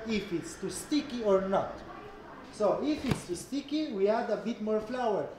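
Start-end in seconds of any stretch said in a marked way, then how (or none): none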